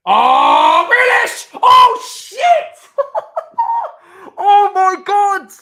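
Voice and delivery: very high, loud goal scream; real shocked screaming. Real, not acted. Fan reacting live to a goal, screamed shock. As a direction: erupt in disbelief, voice shooting right up.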